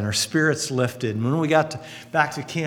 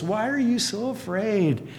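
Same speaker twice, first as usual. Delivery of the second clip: gentle rebuke; 6 semitones higher than usual. Retold or imitated. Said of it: "he says to disciples".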